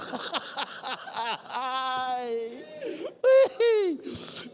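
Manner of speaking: long, high, crying laugh. Real, not acted. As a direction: laugh until it nearly breaks. Laughs at opponent's degree. (tertawa panjang sampai seperti menangis)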